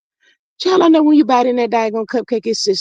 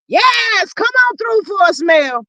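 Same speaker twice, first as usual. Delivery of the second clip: excited call to Mel to join the live; 9 semitones higher, slower than usual. Real, not acted. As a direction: holler her into the stream.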